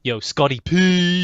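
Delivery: slow, loud hype call. Pretend. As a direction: call out the name, drawn out. Hyped title call.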